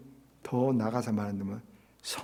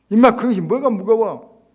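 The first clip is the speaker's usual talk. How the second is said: loud, higher dismissive scolding; scornful rebuke; 8 semitones higher, 12 dB louder than usual. Retold or imitated. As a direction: snap at the complainer. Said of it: "이렇게 말합니까?".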